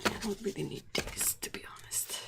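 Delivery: soft whispered aside. Real, not acted. Quiet aside.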